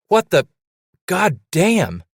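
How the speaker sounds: high, loud, broken-off stammer; stammering shock. A narrator's reading. Trapper seeing his friend's hook hand, stammering shock. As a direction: sputter it out in disbelief.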